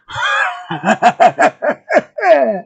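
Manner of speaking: wild villain laugh; maniacal laughter. Acted. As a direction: cackle like a maniac, trailing down. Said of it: "by suddenly maniacally laughing".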